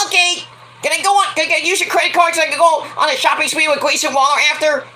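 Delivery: very high, pleading princess squeal; spoiled daughter. Acted. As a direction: wheedle daddy in a put-on girly voice. Plays Tiffany begging for credit card.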